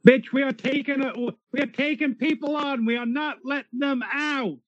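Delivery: angry yelling. Acted. Character yelling during getaway.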